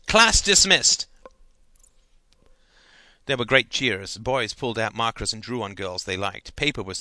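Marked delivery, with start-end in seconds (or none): 0.1-1.1 triumphant high, loud shout; raised voice. A narrator's reading. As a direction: proclaim it to the whole class. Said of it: "raised his fist and his voice".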